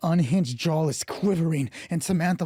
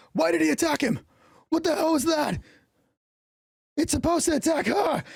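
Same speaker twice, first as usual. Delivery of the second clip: much higher, alarmed character voice; panicked cultist; 11 semitones higher than usual. Acted. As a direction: cry out in high-pitched disbelief. Cultist's shocked outburst in the game.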